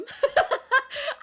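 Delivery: high, loud laugh; burst of laughter. Real, not acted. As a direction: let out a real laugh. Laughs after Gundam story.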